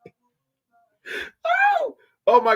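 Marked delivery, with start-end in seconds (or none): 0.1-0.1 voicing the dog. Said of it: "'cause he like".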